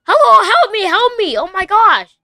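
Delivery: high, loud cries of help me; frantic plea down the phone; calling for help. Real, not acted. Calls security officer for help.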